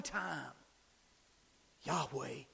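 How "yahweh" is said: soft, low "Yahweh"; whispered "Yahweh" after the shout; hushed. Real, not acted. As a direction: drop to a reverent hush. Sudden drop after climax.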